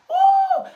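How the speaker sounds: sudden high preacher's whoop; high ecstatic whoop. Real, not acted. Preacher whooping to the congregation, high joyful cry. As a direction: cry out in joy.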